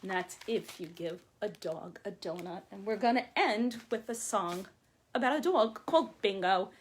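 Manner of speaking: usual speaking voice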